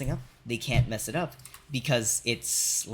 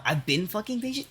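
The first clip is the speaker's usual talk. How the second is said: indignant retort; 10 semitones higher, 4 dB louder than usual. Retold or imitated. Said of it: "I'm like".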